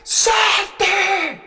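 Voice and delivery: very high, drawn-out falsetto cry; hammed-up squealed command; ecstatic companion's voice. Companion on the float urging him to jump, ecstatic squeal. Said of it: "escucho una voz que me dice".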